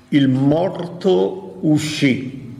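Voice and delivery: very slow, each word weighed: "Il morto uscì"; drawn-out, solemn "Il morto uscì"; slow emphasis. Shown as a demonstration. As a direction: let "Il morto uscì" sink in slowly. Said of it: "Attenzione alle parole".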